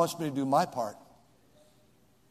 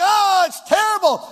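a hint of a despairing person, cried out high and loud; preacher mocking discouraged spouses with a loud high cry; 11 dB louder than usual. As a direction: mimic a self-pitying wail. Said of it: "People think".

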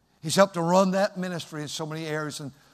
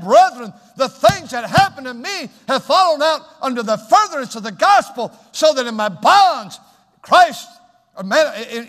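loud, high-pitched pulpit exhortation; fiery preaching; 8 semitones higher, 10 dB louder than usual. Real, not acted. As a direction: preach it with full force to the church. Preaching at full force.

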